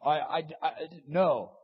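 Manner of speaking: stammered, then a loud no; falter, then blurt the denial; acted hesitant denial. Preacher acting a believer who denies God, stammering loudly.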